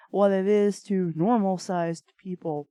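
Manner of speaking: ordinary delivery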